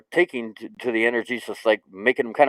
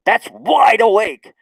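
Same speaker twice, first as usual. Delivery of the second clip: loud, high, drawn-out exclamation; wide-awake burst; 10 semitones higher, 5 dB louder, slower than usual. Shown as a demonstration. Explaining gamma waves to viewers, wide-awake outburst. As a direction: jolt it out high and slow, punching WIDE.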